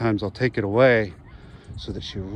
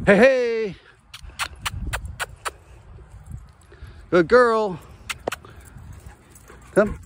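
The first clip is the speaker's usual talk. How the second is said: excited dog praise; 12 semitones higher, much slower than usual. Real, not acted. Owner praising his returning dog, high excited praise. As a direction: cheer her on.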